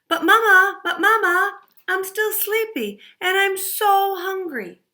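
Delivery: put-on high, whining little girl; whiny sleepy child. Sleepy Goldie whines to her mother. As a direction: plead with Mama like a sleepy kid.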